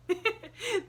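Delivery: put-on high merry girlish laugh. A narrator's reading. Pollyanna laughing merrily at her forgetfulness, high laugh. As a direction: laugh it off brightly. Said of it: "Pollyanna laughed merrily".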